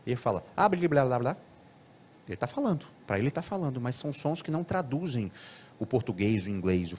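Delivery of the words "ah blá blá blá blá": quick imitated gibberish babbling; unintelligible babble. Shown as a demonstration. Lecturer shows a child speaking untranslatable sounds, unintelligible babble. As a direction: babble nonsense syllables as if really talking. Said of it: "sons ininteligíveis ou sons incompreensíveis".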